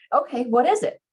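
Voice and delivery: understated nervous dread; mild tremble. A narrator's reading. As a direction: ask it with a touch of nervous anticipation. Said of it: "There's a tremble in his voice".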